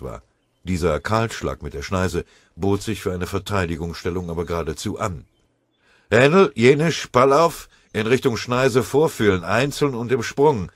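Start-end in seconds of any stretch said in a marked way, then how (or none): 6.1-10.7 high, loud military order; barked command. A narrator's reading. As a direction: bark the order across the clearing. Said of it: "befahl der Feldwebel-Unteroffizier Hähnel".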